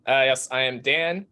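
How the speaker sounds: ordinary talk, nothing marked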